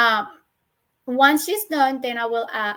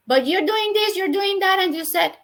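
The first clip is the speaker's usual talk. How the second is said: put-on child arguing back; 7 semitones higher, 3 dB louder than usual.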